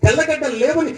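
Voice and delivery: mock complaint, pitch climbing item by item; imitated grumbling. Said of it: "దేవుడి మీదే సణుగుతున్నట్టు". (సణుగుతున్న జనాన్ని అనుకరిస్తూ ఫిర్యాదు)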